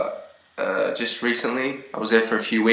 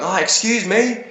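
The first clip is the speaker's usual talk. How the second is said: mock girl calling out; 10 semitones higher than usual. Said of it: "they're going".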